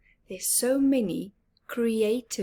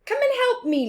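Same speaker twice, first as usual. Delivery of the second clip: much higher, louder call to help; Big Mouse calling; 7 dB louder, much faster than usual. Acted. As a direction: call Little Mouse over. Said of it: "said Big Mouse".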